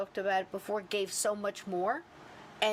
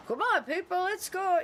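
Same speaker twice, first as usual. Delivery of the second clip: higher, louder mock call to the group; rally the people like a tour leader; 9 semitones higher, 3 dB louder than usual. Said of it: "he does this".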